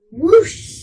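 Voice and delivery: loud, high whoosh; whoosh sound. A narrator's reading. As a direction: sweep the sound up like a rushing broom. Broom takes off.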